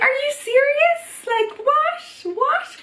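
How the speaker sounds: high, stunned disbelief; can't believe what she's hearing; squeaky, outraged are you serious; incredulous disbelief. Real, not acted. Incredulous at being told not.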